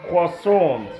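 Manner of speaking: croissant modelled as French "kwah-son"; French pronunciation. Shown as a demonstration. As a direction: say croissant deliberately with a "kwa" start. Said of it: "It is".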